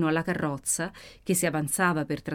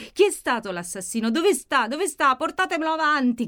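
high, loud demanding cry; shouting; 8 semitones higher, 6 dB louder than usual. Acted. Maddalena demands the killer, shouting. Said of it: "gridava Maddalena".

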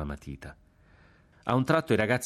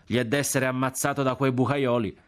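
higher Florentine street talk; working-class Florentine accent; 6 semitones higher than usual. A narrator's reading. Condemned boy scorns his killers, working-class Florentine accent. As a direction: complain in popular Florentine dialect. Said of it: "Parlavano con l'accento popolano di San Frediano".

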